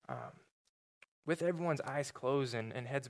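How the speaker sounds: soft, low pastoral prayer; quiet prayer. Acted. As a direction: pray gently over the congregation. Leads closing prayer.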